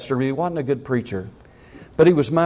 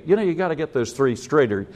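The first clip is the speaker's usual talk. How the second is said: ordinary talk, nothing marked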